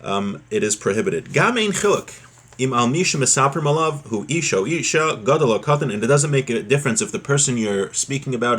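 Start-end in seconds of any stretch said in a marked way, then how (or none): none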